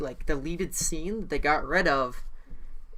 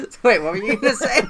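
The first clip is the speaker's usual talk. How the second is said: laughing; 6 semitones higher, 4 dB louder, faster than usual. Real, not acted. Still laughing, asks to continue.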